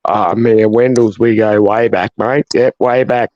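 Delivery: mock Australian accent. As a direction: do T F's Australian accent, pitched low. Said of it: "And then obviously the accent".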